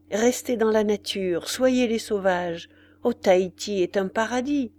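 plain reading voice

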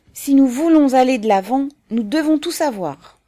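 high, loud, impassioned argument; Fédia exclaiming. A narrator's reading. Fédia arguing with his comrades, exclaiming. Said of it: "s'écria Fédia".

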